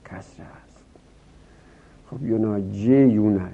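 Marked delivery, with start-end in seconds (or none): 0.0-0.8 whispered under breath; کسره است whispered. Real, not acted. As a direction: breathe it out to yourself. Aside in a whisper.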